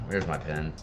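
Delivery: ordinary talk, nothing marked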